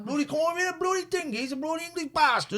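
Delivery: hammed-up Cornish rant; Cornish yokel accent. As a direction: shout off the English in broad Cornish. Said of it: "How do you talk in Cornwall?".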